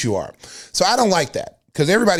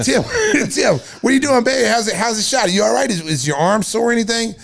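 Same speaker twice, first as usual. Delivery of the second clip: high mock concern; 7 semitones higher than usual. Calling friend with concern.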